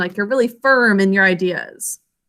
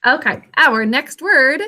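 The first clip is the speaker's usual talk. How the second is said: usual speaking voice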